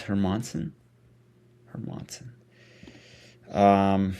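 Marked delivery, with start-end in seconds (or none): 1.7-2.3 breathy whispered name check; whispered to self. Real, not acted. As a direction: mutter the name under your breath.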